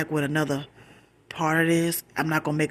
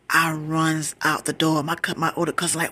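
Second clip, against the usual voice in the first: normal talking voice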